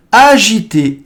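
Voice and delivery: Agité thrown out high and loud, a-zhi-té; lively agitated demo. Shown as a demonstration. Teacher acts agitation while modelling Agité, animated. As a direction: act out Agité with energy. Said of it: "Please hear the words and repeat after me".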